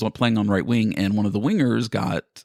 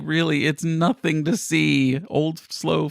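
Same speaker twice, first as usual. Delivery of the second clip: higher, slowed self-deprecating disbelief; self-mocking protest; 6 semitones higher, slower than usual. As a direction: brush off the compliment, mock-humble. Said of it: "And I'm just like".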